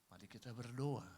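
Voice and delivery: a hint of a stock pastor's prayer; mock pastor prayer. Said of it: "pendeta bisanya".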